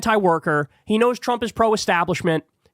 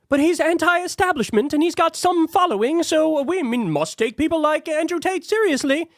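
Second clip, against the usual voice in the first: mocking voice; 10 semitones higher than usual. Mocking the other side's argument.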